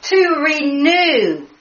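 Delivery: high, slow, overcome with excitement; gasping with joy at the discovery; real excitement. Real, not acted. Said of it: "I'm getting excited".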